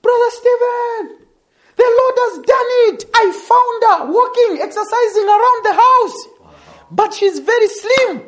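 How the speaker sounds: imitating the excited sister on the phone. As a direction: relay the good news breathless with excitement. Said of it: "she was excited".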